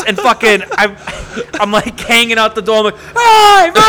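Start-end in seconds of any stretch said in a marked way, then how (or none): 3.0-3.7 high, loud shout down the street; calling out. Retold or imitated. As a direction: yell to get his attention from the truck. Hanging out truck door calling.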